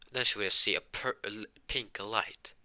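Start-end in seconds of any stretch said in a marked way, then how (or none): none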